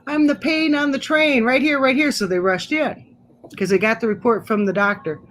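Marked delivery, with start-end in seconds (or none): 0.1-2.1 higher, calling down the hallway. Retold or imitated. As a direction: announce yourself to the nurses. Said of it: "So I hollered out".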